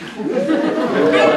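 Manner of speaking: big gloating dragon laugh, loud and high; booming dragon laugh. Acted. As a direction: cackle like a triumphant dragon. Dragon gloats over captured prince.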